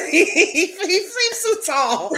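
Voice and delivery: drawn-out words through high laughter; laughing. Real, not acted. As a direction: giggle your way slowly through it. Cracking up at the joke.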